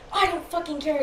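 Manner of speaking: high, petulant imitation; retold angry swearing. Boyfriend brushing off a telling-off, angrily defiant. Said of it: "swearing at me".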